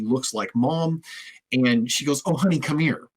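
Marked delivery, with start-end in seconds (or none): 2.2-3.1 higher, gentle voice, arms-open welcome; tender coaxing. Retold or imitated. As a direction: coax a frightened child to come to you. Deputy comforting a lost toddler.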